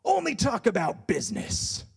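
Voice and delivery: put-on high, loud accented shout; mock boss yelling in an accent; imitated boss yell. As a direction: bellow it like the boss telling off staff. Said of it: "my boss yelled at us".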